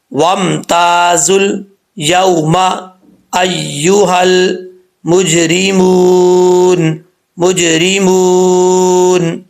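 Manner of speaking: slow chanted tajweed recitation; Quran recitation. Shown as a demonstration. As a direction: recite it slowly for practice. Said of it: "practice کرنی ہے". (آہستہ تلاوت کا انداز)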